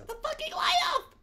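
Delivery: very high, rapid, urgent yell; exasperated yell. Real, not acted. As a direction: yell it fast and high at the player. Urging the on-screen player again.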